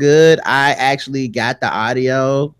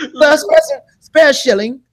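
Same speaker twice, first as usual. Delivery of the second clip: high laughing mock-English voice; high, laughing mock British accent; 10 semitones higher, slower than usual. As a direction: ham it up like a Dickens urchin.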